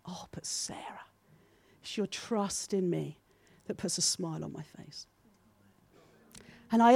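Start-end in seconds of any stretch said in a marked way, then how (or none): 0.0-5.1 tender whisper. Retold or imitated. Preacher voicing God's loving whisper to her, hushed. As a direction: whisper it like God leaning close. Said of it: "I heard him whisper".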